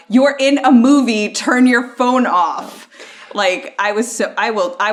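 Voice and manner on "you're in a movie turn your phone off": loud, higher-pitched scolding of a phone user. Retold or imitated. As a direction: re-enact telling someone off, loud and slowing at the end. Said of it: "said out loud".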